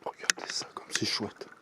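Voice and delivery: chuchoté, sans voix; whisper. Real, not acted. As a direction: souffle les mots à voix basse, émerveillé. Filme en chuchotant, émerveillé. (murmure ravi devant le paysage)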